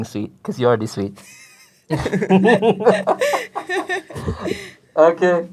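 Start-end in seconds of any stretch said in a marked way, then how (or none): 1.9-4.7 high laugh. Real, not acted. Laughs at his own line.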